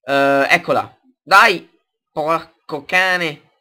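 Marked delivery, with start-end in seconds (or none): 1.2-3.5 loud burst, then slow curse; explosive italian cursing. Real, not acted. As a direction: blow up at the game. Failure in the game, swearing.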